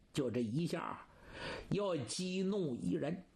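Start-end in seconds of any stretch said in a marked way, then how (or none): none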